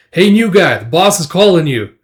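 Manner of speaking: rowdy mercenary hollering for the new guy; calling out. Retold or imitated. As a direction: yell for the new guy to come.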